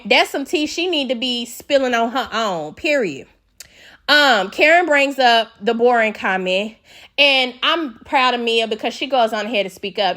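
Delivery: normal talking voice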